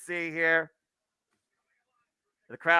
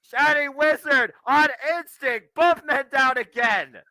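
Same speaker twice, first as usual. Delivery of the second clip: excited call; 8 semitones higher, slower than usual. Real, not acted. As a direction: stretch out the big call. Calling the move.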